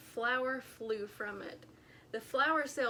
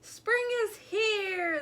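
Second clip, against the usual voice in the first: high, slow drawn-out shout; 9 semitones higher, 5 dB louder, slower than usual. A narrator's reading. Groundhog shouting the news as he runs. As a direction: keep shouting it out. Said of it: "kept shouting".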